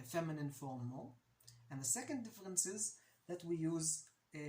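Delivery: ordinary talk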